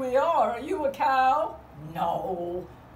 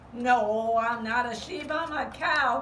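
normal talking voice

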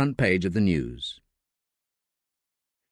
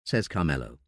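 ordinary delivery, nothing marked

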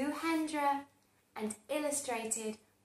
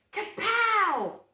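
loud kapow; 9 semitones higher, 9 dB louder than usual. A narrator's reading. Action sound word.

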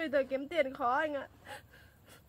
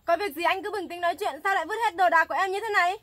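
louder, pleading protest over her thrown-out things; upset pleading; 3 semitones higher, 8 dB louder than usual. Acted. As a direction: beg him to calm down, raising your voice. Tenant begs landlord not to evict.

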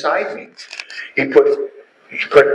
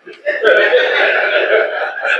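quick unvoiced laugh up high; high breathy laugh; 10 semitones higher than usual. Real, not acted. Remembering his wife's demand, high laugh. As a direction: laugh off the idea.

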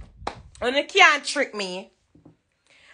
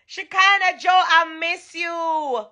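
longing call; 7 semitones higher, slower than usual. Real, not acted. Calling friend again.

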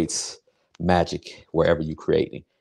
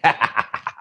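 higher-pitched laugh; 4 semitones higher than usual. Real, not acted. Cook laughing about hens in the bag, higher laugh. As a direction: laugh at your own joke.

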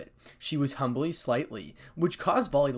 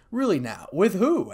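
louder, curious question rising high; intrigued, pitched up at the end; genuinely curious; 5 semitones higher, 7 dB louder than usual. A narrator's reading. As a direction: ask with keen interest. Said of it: "genuinely curious".

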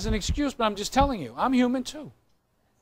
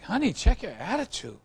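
mock husband scolding; 7 semitones higher, slower than usual. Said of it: "I was like".

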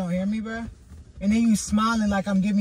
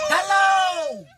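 shouting; 10 semitones higher than usual. Real, not acted. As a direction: yell hello to break the silence. Ignored in the car, shouting.

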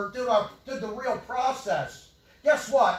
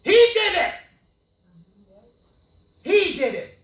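accusing shout; 7 semitones higher, 10 dB louder than usual. Real, not acted. Accusing the governor.

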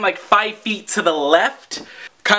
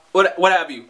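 ordinary talk

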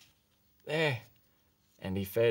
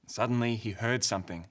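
plain reading voice

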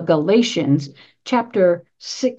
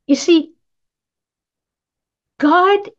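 ordinary delivery

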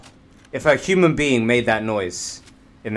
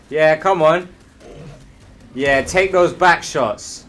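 higher, slow, cocky taunts; taunting; 6 semitones higher, slower than usual. Acted. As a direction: gloat while blasting them. Taunting enemies while shooting.

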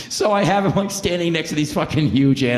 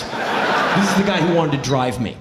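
usual speaking voice

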